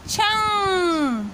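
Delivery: छंग stretched very slowly, nasal ending held; drawn-out syllable. Shown as a demonstration. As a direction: sound out छंग slowly for the class. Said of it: "उच्चारण हो जाएगा".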